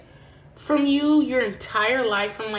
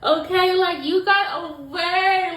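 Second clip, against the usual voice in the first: excited emphasis; 8 semitones higher, 6 dB louder than usual. Real, not acted. Joyful emphatic exclaim.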